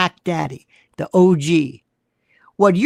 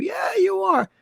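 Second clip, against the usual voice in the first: sarcastic yell; 10 semitones higher than usual. Acted. Sarcastic retort to imagined provocateur.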